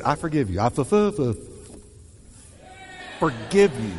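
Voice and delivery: stammering forgiveness with a laugh; mock struggle to forgive; struggling to say it. Shown as a demonstration. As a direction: force the words out halfway. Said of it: "Say it".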